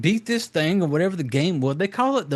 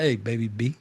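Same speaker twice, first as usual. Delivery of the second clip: lightly acted, lower-pitched quote; imitated brother; 7 semitones lower, 4 dB quieter than usual. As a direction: drop low to mimic the brother. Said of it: "he was just like".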